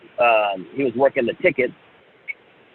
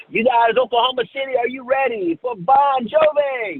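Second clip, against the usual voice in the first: hyped DJ; 12 semitones higher than usual. Retold or imitated. Local DJ revving up the crowd, high announcer voice. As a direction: pump up the arena like a radio DJ. Imitating the DJ on the mic.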